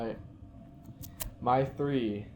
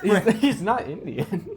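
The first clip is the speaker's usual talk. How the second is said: laughing; 9 semitones higher, 6 dB louder, slower than usual. Real, not acted. Laughing at accent.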